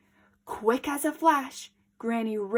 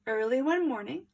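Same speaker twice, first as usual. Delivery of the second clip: plain reading voice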